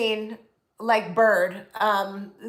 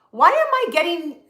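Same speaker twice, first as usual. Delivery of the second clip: puzzled; 7 semitones higher, 3 dB louder than usual. Retold or imitated. Retelling her own puzzled reaction.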